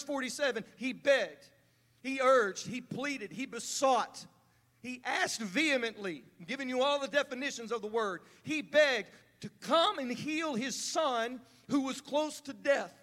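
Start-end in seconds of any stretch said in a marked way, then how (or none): none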